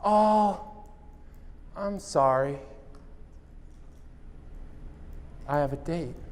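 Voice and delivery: a hint of the girl letting him down; Tracy turning down a date, softly voiced; lightly voiced Tracy. Tracy declines on phone.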